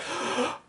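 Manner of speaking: sudden awestruck intake of breath; sharp gasp. Retold or imitated. Showing how a listener reacts to the poet, with a sharp gasp. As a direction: gasp as if struck by a sensation. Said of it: "you get this sensation".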